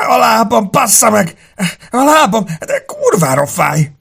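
high, loud, sobbing curses; screaming in pain. A narrator's reading. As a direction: wail and swear in agony. Wounded man screaming about his leg. (sírós, fájdalmas üvöltözés)

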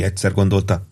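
ordinary delivery

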